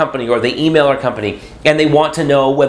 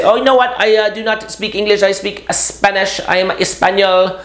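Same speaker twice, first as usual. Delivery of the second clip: bad Spanish accent; 6 semitones higher than usual. Kid pretending not to speak English, in a terrible Spanish accent. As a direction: ham up the worst Spanish accent you can. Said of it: "absolute worst Spanish accent anybody can do".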